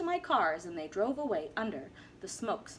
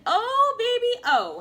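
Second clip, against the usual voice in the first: put-on high, loud, gleeful oh baby; gleeful crowing; 12 semitones higher, 9 dB louder than usual. Once-ler crowing over his booming business, high and loud. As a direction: crow it out with delight.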